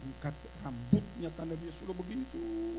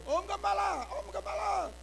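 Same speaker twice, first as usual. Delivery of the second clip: put-on child calling out; 13 semitones higher than usual.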